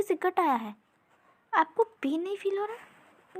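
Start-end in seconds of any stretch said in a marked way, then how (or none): 1.5-3.4 higher, slow, amazed asking; astonished question. Acted. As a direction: stretch it out in disbelief. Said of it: "हैरान होकर कहा".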